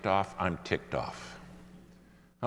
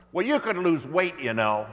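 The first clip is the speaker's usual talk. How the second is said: flight attendant snapping back, much higher and louder; real loud retort; 9 semitones higher, 4 dB louder than usual. Retold or imitated. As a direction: fire it back loud so the whole cabin hears. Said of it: "real loud".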